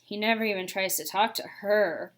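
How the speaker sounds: plain reading voice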